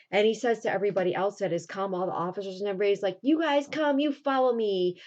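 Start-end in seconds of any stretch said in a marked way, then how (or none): 3.2-5.0 calling out. Retold or imitated. Cyrano calls the officers along.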